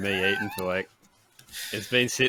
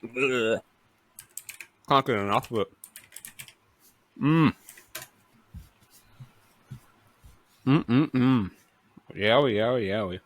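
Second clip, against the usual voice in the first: chewing while talking. Real, not acted. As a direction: mouth full of chocolate. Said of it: "chocolate melting all over my chin".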